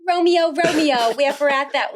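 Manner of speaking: hammed-up New Jersey Shakespeare; thick Jersey accent. Student in first Shakespeare class, in thick Jersey accent. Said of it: "it was very thick".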